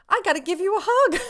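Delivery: high, loud quote bursting into laughter; gleeful retold offer. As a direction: blurt it out, giddy with delight. Quotes idol's offer, overcome with glee.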